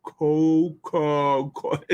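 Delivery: slow, stretched with lips kept apart; lips held apart. Shown as a demonstration. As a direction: say it very slowly without closing your lips. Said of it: "try to say it without".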